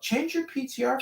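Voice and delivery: high-pitched, insistent plea; exasperated urging. Real, not acted. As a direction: urge them with voice pitched right up.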